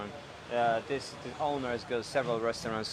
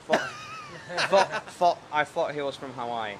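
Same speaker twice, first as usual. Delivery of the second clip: laughing; 5 semitones higher than usual. Real, not acted. Laughing at a viewer's joke.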